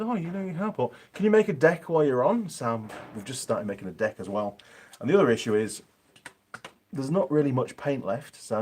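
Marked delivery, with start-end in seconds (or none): 0.0-2.5 lightly voiced wife, pitched up; a higher-pitched, light imitation of his wife; imitating the missus. Wife asking for a deck while he's on the carport, lightly imitated. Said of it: "then Missus Mechanic said".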